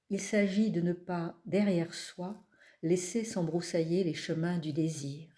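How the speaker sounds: measured, unhurried recital with long pauses; poetry reading. Acted. Poet reading from her collection to an audience, measured recital. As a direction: let each image settle before the next. Reads poems aloud.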